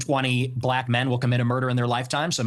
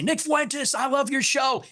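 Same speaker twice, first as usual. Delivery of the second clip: much higher, gushing fan voice; imitating a fan; 10 semitones higher, slower than usual. Nick imitating fans praising him, high gushing voice. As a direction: lift it high like an excited fan. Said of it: "They say".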